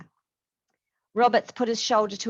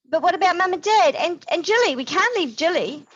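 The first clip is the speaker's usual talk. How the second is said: put-on high, loud childlike protest; young boy begging not to leave; pleading boy; 11 semitones higher, 7 dB louder than usual. A narrator's reading. James begging to wait for his parents, high child voice.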